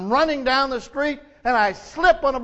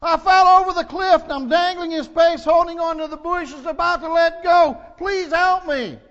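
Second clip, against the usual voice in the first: much higher, louder, pleading cry; desperate plea; 8 semitones higher, 6 dB louder than usual. Acted. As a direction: beg for rescue, panicked. Tourist pleads for rescue.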